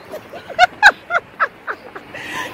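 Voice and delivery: high, delighted burst of laughter; real high laughter. Real, not acted. Child's funny answer.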